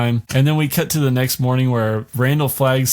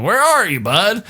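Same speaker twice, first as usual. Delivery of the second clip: calling out; 9 semitones higher than usual. Retold or imitated. Pilot calling for Trash.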